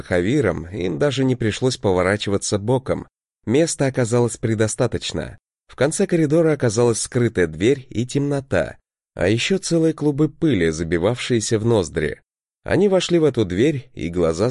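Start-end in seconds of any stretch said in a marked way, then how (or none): none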